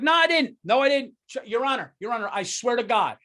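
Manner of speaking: high, loud panicked pleading; frantic innocent defendant; desperate denial. Pretend. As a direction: beg the judge in a panic. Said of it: "I'd be like".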